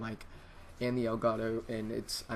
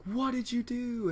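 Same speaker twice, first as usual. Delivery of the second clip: dad's freak-out, pitched high and drawn out; 12 semitones higher, slower than usual. Retold or imitated. Dad texting his kid in alarm, mock freak-out. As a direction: imitate a panicking dad, high and slow. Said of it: "he's freaking out".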